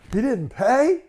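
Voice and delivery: high, loud mock disbelief, nearly shouting; overacted shock at being cheated; fake shocked outrage. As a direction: ham up the outraged innocence. Employee fakes shocked outrage.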